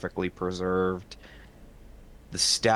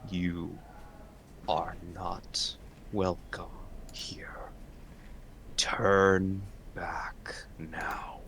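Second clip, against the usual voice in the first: deep rumbling voice; 5 dB quieter, slower than usual. Acted. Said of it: "all of you hear a deep and rumbling voice".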